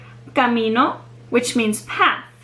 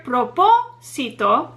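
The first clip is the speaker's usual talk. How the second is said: "propósito" pulled apart, PO held and stressed; stress on third-to-last syllable; slower than usual. Shown as a demonstration. Teacher asking learners to hear it, stress on PO in "propósito". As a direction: punch PO in "propósito". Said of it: "third to last syllable is the one that receives the stress".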